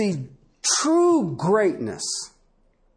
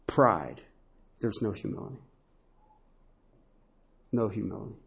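quiet emphasis; 8 semitones lower, 9 dB quieter, faster than usual. Acted. Sermon emphasis going soft.

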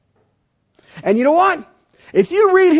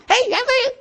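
much higher squeaky chipmunk chatter; 13 semitones higher than usual. Retold or imitated. Preacher imitating Patton's voice as a squeaky chipmunk. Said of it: "It sounded like a little chipmunk".

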